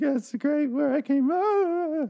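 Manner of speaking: very high, slow, sugary voice; a high sing-song sweet lady; Carol's sweet voice. Retold or imitated. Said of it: "And she's like".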